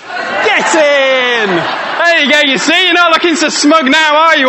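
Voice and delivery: triumphant cheer. Real, not acted. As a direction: crow over the other side with glee. Celebrating left thumb win.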